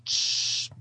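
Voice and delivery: normal talking voice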